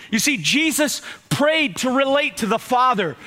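ordinary delivery

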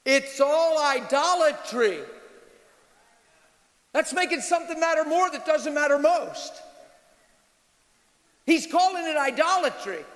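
high, emphatic pulpit delivery; forceful preaching. Real, not acted. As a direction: preach it hard, stretching the key phrases.

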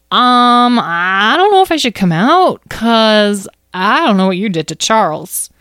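high, loud, hesitant little voice; wary small creature. Acted. Mouse refuses to leave hiding, wary. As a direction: balk from a hiding place, worried. Hidden mouse afraid to come out.

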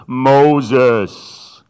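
booming, echoing call from the bush; echoey voice of God. Acted. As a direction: call his name like an echo from a burning bush. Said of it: "with an echoey voice".

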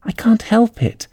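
high, slow, meek little voice. A narrator's reading. Alice excuses herself to the Dormouse, very meekly. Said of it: "said Alice very meekly".

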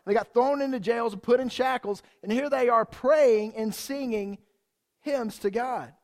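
ordinary delivery

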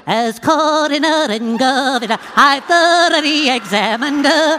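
high, loud little-creature impression; Munchkins. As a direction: pipe it out in a high, quavering character voice. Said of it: "do the Munchkins from The Wizard of Oz".

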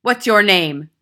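loud, abrupt demand; stern headmistress shout; barked question. A narrator's reading. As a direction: bark it at a small boy. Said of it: "she barked".

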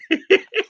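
a sudden high laugh; high burst of laughter. Real, not acted. Telling her story, she bursts into a laugh. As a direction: laugh out at yourself.

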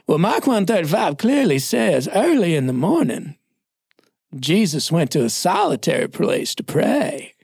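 stretched, lilting Southeastern recitation; Southern pastor impression. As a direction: quote it grandly in an imitated Southern accent. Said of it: "he talked like this".

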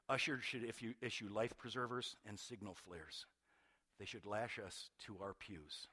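soft, low intense reading; hushed reading. Acted. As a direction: draw the congregation in close. Quoting Annie Dillard, hushed.

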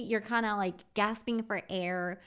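ordinary delivery

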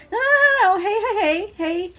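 calm her down over and over; soothing tone. Acted. Andrew soothing his crying friend in the bathroom, urgent reassurance. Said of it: "he says in that one tone".

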